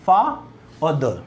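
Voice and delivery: "फा", pause, then "द"; broken into syllables. Shown as a demonstration. Teacher breaks father into two syllables, saying "फा", then "द" separately. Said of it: "हमने इसको break कर दिया".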